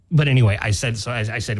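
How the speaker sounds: usual speaking voice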